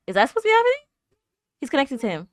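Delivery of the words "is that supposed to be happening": high, louder, startled question; can't believe what's happening; shocked disbelief. Real, not acted. Shocked by a twist, high startled disbelief.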